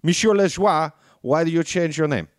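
hammed-up French accent; mock French accent. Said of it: "bring back the French pronunciation".

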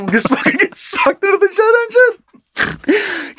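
high-pitched, stretched out through laughter; helpless real laughter in the voice; cracking up. Real, not acted. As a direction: struggle to get it out while laughing. Cracking up telling the accident.

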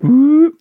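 high loud mock whoop; robot whoop. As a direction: yelp it out high like a machine. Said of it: "he just goes".

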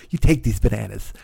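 a quick, lightly put-on comic voice; hint of a famous comedian voice; Damon Wayans impression. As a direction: toss off a sitcom-style line in a borrowed voice. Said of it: "he was like Damon Wayans".

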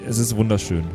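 ordinary talk, nothing marked

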